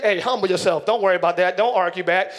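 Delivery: low, steady counsel in God's voice. Acted. Preacher voicing God telling a believer to humble himself, low and steady. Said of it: "God's voice is usually".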